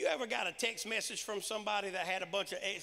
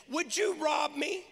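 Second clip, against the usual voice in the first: mock shout; 6 semitones higher, 5 dB louder, slower than usual. God confronting the people.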